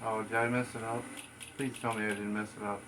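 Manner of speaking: normal talking voice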